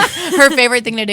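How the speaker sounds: teasing through a laugh. Real, not acted. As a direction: say it laughing, poking fun. Teasing co-host about ghosting.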